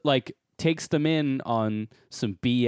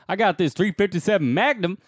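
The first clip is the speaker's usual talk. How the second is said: put-on southern cop accent; 8 semitones higher, 5 dB louder, faster than usual. Podcaster imitates the small-town cop's threat, loud Southern accent. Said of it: "He has a southern accent".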